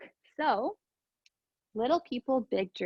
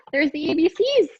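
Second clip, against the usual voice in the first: ordinary delivery, nothing marked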